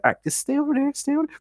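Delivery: high, hushed mock panic; hammed-up frightened squeak; nervous pleading. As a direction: keep the spider back in a high, nervous voice. Retelling pleading with advancing spider.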